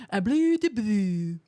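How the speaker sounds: slow, loud mock French gibberish with no consonants; mock French babble. Streamer mocking French to chat, slow fake French babble. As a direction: mumble fake French slowly. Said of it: "there are no consonants".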